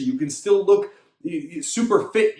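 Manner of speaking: ordinary talk, nothing marked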